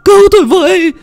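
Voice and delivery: very high, loud panicked screaming; yelling for help. A narrator's reading. Fisherman fleeing a corpse calls the villagers, screaming in panic. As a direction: scream to rouse the whole village. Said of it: "vừa chạy vừa la làng".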